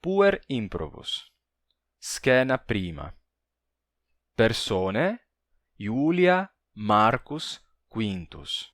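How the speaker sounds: slow, deliberate Latin text reading for learners; slow dramatic reading. Acted. Teacher reads the Latin play aloud to students, slow and clear. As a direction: read each phrase slowly, pausing like a storyteller.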